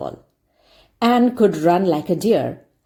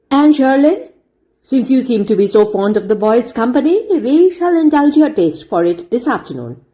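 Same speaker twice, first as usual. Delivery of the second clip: higher, louder, mocking teacher voice; sarcastic schoolmaster; 5 semitones higher, 4 dB louder than usual. A narrator's reading. Schoolmaster mocking Anne before the class, sarcastic. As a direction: sneer the punishment at her. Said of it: "he said sarcastically".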